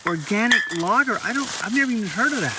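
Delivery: high, incredulous, fast at the end; baffled that anyone dumps beer; surprised disbelief. Real, not acted. Finds full beers dumped.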